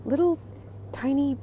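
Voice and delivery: high-pitched, word by word; high little voice of delight; delighted cooing. Real, not acted. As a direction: coo over something tiny and cute. Delighted by tiny cheese.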